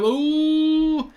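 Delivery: a high, drawn-out ooh. Real, not acted. Vlogger teasing his viewers over the transfer choice, high drawn-out ooh. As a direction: let the ooh soar up in mock suspense.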